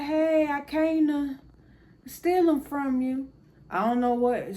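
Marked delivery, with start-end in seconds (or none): 0.0-3.3 high, drawn-out call; retold sing-out hello, stretched and loud; playful greeting. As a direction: sing it out high and slow to an elder. Retells greeting granny about taking grandson.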